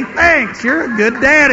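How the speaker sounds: proud dad replays his son's words with delight; excited retelling. Retold or imitated. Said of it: "All he did was just say".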